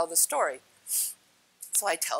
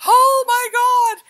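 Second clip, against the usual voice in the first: very high, loud, drawn-out mock shriek; shocked outcry; 13 dB louder, much slower than usual. Retelling reaction to the man's fit, shrieked shock. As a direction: wail it out in exaggerated shock. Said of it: "And I'm like".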